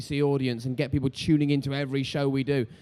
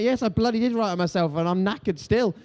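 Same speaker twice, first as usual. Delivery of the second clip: higher, indignant and exasperated; indignant reply; 6 semitones higher than usual. Retold or imitated. Author answering doubters, higher and mock-indignant. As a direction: insist with mock outrage. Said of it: "I'm like".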